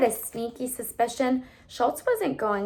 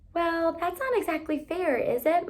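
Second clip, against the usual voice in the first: lightly voiced mother, raised and gentle; mild mom voice; 8 semitones higher than usual. A narrator's reading. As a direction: soothe her gently.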